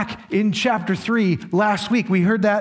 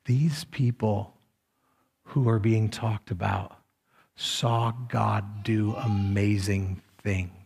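quiet earnest appeal; 8 semitones lower, 4 dB quieter than usual. Acted. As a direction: draw the listeners close with a hushed, serious warning. Quiet earnest altar call.

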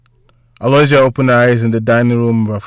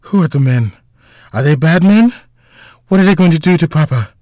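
rising, high-pitched worried questions; anxious child; 5 semitones higher, 3 dB louder than usual. A narrator's reading. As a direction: ask urgently like a scared little boy. Frightened child questions his sister.

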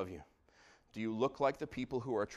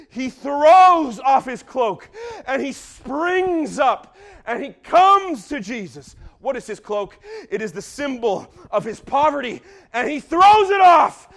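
higher, louder, slow in places; preaching at full force; 21 dB louder, slower than usual. Real, not acted. Preacher building the close for the congregation. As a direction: build to the climax.

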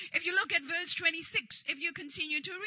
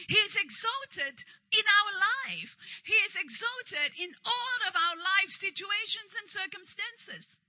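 raised, emphatic sermon voice; fervent preaching; 3 semitones higher, 5 dB louder than usual. Real, not acted. Preacher assures congregation of God's protection, fervent preaching. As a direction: proclaim it forcefully to the congregation.

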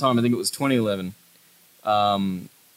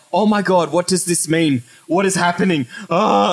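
mock panic, louder and higher; freaking out; 5 semitones higher, 4 dB louder than usual. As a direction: play up a rising, alarmed freak-out. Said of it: "I started freaking out".

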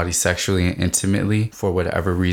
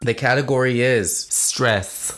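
quiz announcer; 5 semitones higher, slower than usual. Acted.

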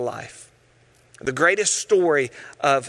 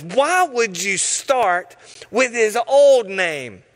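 usual speaking voice

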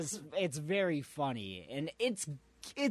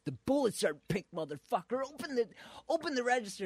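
mock robber threat; 10 semitones higher, much faster than usual. Retelling a robber's threat to a cashier, hammed-up shout. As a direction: bark the demand like a jittery robber. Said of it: "And he goes".

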